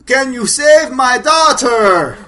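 high, loud, slow plea; loud melodramatic movie acting; hammed-up movie line. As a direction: drag out the plea like bad melodrama. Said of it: "they are acting out every part of that movie".

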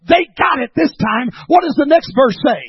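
high-energy sermon challenge; impassioned preaching. Real, not acted. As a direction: fire it out to the congregation. Preaches at full force.

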